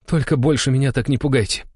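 relieved father. A narrator's reading. Father relieved after danger.

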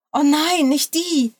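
high, loud cry of protest; exclaiming. A narrator's reading. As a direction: call out in dismay, pitch jumping up. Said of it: "rief sie aus". (hoch und laut ausgerufen)

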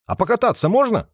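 high, eager ask; eager question. Acted. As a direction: ask like an excited kid. (нетерпеливый вопрос)

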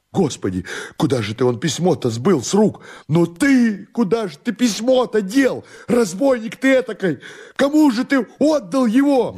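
rising into a very high, desperate outcry; frantic demand. Acted. Master demands the lost letter from servant, frantic high outcry. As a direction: demand it in mounting high-pitched panic.

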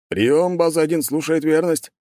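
radio operator voice. Acted. Base answers on radio.

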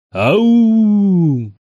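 long, high, loud wolf howl; heart-rending howl. A narrator's reading. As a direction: howl it out with real anguish. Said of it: "раздался душераздирающий вой".

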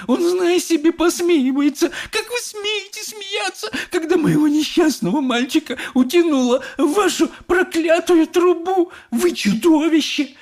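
very high, loud screeching accusation; furious shrieking. A narrator's reading. Mother raging at Wonka, shrieking. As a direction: screech in outrage as a hysterical mother. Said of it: "визжала она".

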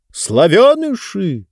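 slow, drawn-out lecturing announcement; didactic. Acted. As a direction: pronounce it like a stern lesson. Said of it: "назидательно сообщил".

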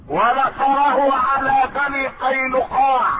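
mounting sermon declamation; full-force preaching. Acted. Chants a list of victories.

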